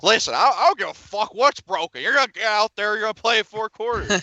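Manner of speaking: a coach barking at a hurt player; angry Saban impression. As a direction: bark orders like an angry college coach. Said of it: "Nick Saban's like".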